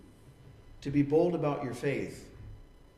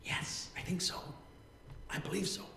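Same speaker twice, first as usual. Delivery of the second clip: breathy, voiceless, much softer; whisper; 11 dB quieter than usual. Retold or imitated. Pharisee looking around, whispering yes. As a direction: admit it so no one hears. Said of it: "Why are we whispering?".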